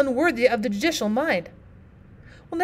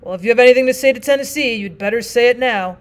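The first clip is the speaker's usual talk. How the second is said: a touch of the stern judge, louder; 7 dB louder than usual. Acted. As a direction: judge asks the partner, lightly voiced. Judge calls him back.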